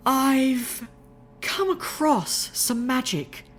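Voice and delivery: high, slow cautious lady's voice. Acted. Rarity broaching dark magic, slow high cautious voice. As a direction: ease it out slowly, high and careful. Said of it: "Rarity said cautiously".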